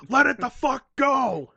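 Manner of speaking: slow, high, loud demand; hammed-up angry order; fed-up shouting. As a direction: yell each word, exasperated. Acted quarrel over an apology.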